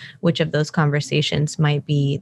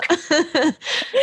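delighted laugh; 12 semitones higher than usual. Real, not acted. Laughing at sweet review.

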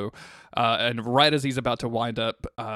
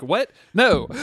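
mock exasperated; 7 semitones higher, 7 dB louder than usual. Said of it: "And he's like".

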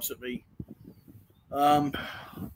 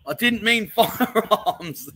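reading it out through laughter; slow, drawn-out words breaking into a laugh; cracking up; 4 semitones higher, 4 dB louder, much slower than usual. Real, not acted. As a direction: laugh helplessly as you read. Cracks up reading chat comment.

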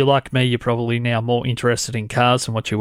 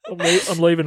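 higher voice, real tears of laughter; crying with laughter; 11 semitones higher than usual. Real, not acted. Host apologises to listeners mid-fit, crying with laughter. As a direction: apologise while helpless with laughter.

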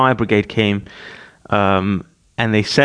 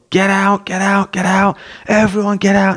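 high, urgent mock yell; retold yell; 9 semitones higher than usual. Dad clearing the house from fire, retold urgent yell. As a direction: re-enact yelling an evacuation, pitched high. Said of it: "I just yelled".

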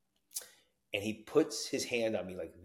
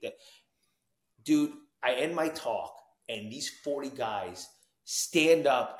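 ordinary delivery

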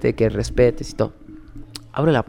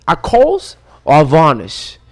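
slow, louder doorwoman voice; imitating the doorwoman; 6 dB louder, slower than usual. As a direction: play the British doorwoman asking. Imita a la cadenera. (imitando a la cadenera, lento)

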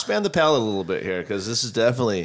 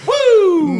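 big high whoop of delight, much louder; excited whoop; 10 dB louder than usual. Real, not acted. Cheering a friend at the table with a loud whoop. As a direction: let out a loud happy cheer.

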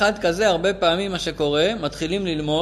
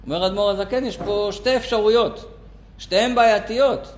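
ordinary delivery

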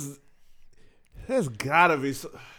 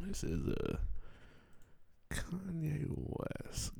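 slow dramatic whisper; 16 dB quieter, slower than usual. Acted. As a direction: hush it like a secret reveal. Whispered dramatic name reveal.

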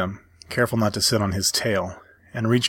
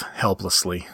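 plain reading voice

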